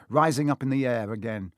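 usual speaking voice

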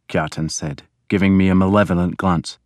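usual speaking voice

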